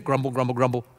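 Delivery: mock muttering, low and soft; grumbling murmur. Church member feeling guilty about adoption, in a soft mock grumble. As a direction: grumble under your breath like a complainer. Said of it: "this low-toned murmur".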